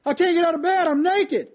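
a mock put-upon refusal; refusing wife.

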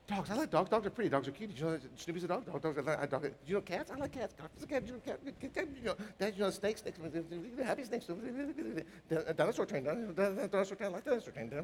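put-on light, soft toddler babble; a hint of a jabbering little kid; mimicking a toddler's soft jabber to the congregation; imitating a child. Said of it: "the kids are kinda going".

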